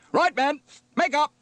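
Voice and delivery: high, loud officer's command; shouted order. Acted. Officer gives orders to his crew.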